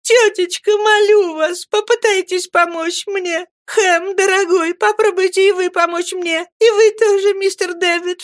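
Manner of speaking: put-on high, tearful, pleading girl's cry; a young girl's high, weeping plea. A narrator's reading. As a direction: beg them all for help through tears. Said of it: "закричала".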